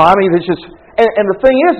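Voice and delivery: ordinary talk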